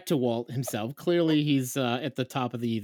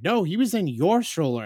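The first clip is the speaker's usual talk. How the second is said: higher-pitched imitation of wife's retort; retold alarmed retort; 6 semitones higher, 4 dB louder than usual. Imitating wife answering husband over missing son, alarmed. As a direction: throw the mistake back, mildly panicked.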